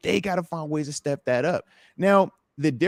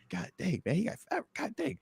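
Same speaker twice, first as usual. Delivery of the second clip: much softer, subdued mock dismay; imitating a deflated onlooker under their voice; muted reaction; 4 semitones higher, 13 dB quieter than usual. Player contrasts the feeling at another game, hushed dismay. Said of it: "It was not the same".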